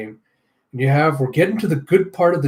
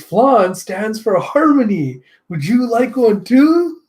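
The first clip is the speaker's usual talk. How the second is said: high, slow, louder sing-song pitch; smug villain; 9 semitones higher, 5 dB louder, slower than usual. Acted. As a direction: sell it like a creepy flan salesman. Dusty the flan-pushing villain.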